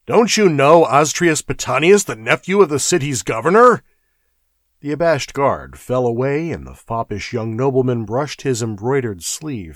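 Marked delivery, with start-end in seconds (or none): 0.1-3.8 angry. Acted. Prefect still cursing guard.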